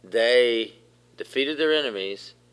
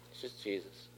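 much softer, almost under the breath; soft hushed repeat; 17 dB quieter than usual. Real, not acted. Pastor repeating to the congregation, hushed and soft. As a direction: let the name sink in quietly.

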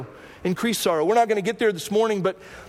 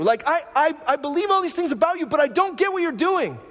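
frustrated Martha; 9 semitones higher than usual. Retold or imitated. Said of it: "she goes".

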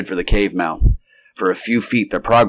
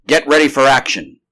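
loud, raised call to readiness; urgent command, pitched up; announcing; 6 semitones higher, 6 dB louder than usual. A narrator's reading. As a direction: alert the crew to act. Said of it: "he announced".

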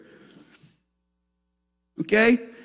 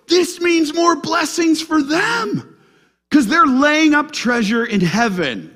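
excited exclaim; 7 semitones higher than usual. Retold or imitated. Paul quoted, rejoicing.

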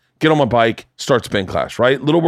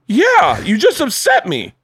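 high-pitched retold indignation; hammed-up exasperation, voice leaping high; upset. Husband arguing back at wife, upset. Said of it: "And then I got upset".

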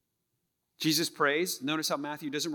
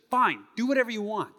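higher, louder fed-up line; louder, exasperated spouse; frustrated; 6 semitones higher, 5 dB louder than usual. Acted. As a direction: spit out the resigned permission. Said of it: "We're communicating frustration".

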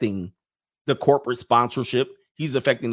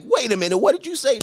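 high mock double take; 11 semitones higher, 4 dB louder than usual. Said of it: "You're like".

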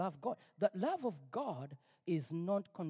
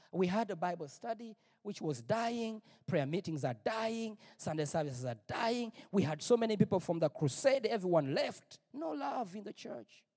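ordinary delivery

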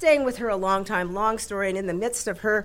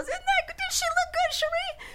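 very high-pitched gushing to a deacon; mock giddy squeal through laughter; imitating laughing husband. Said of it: "he's just laughing".

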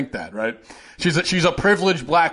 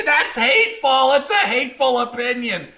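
mock indignation; 9 semitones higher than usual. Mock example of someone whining.

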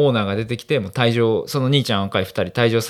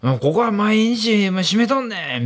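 put-on old man yelling; 9 semitones higher than usual. Old man's retort in quarrel.

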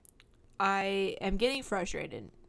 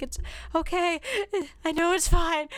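much higher, strained, near tears; holding back tears; 9 semitones higher, 4 dB louder than usual. Retold or imitated. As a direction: force it out while trying not to cry. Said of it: "trying to, like, not cry".

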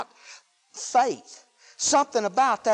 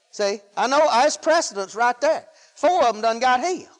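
usual speaking voice

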